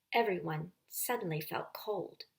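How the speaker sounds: ordinary delivery, nothing marked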